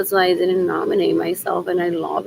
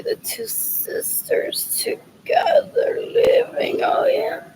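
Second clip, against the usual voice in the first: mock breathy whisper; slower than usual. Mocking sexual fantasy voice.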